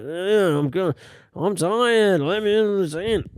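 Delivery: a hint of a high, drunk voice; hammered guy. Drunk man asking to be let into the tent, lightly slurred. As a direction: lightly play the tired drunk. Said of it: "he was obviously hammered".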